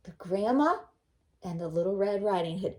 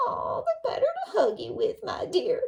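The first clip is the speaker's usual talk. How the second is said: sly wolf voice; 10 semitones higher, 3 dB louder than usual. Acted. Wolf answering Red.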